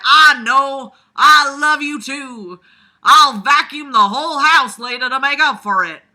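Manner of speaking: calling back. Retold or imitated. Son calling back to mom.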